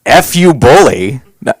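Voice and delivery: ordinary talk, nothing marked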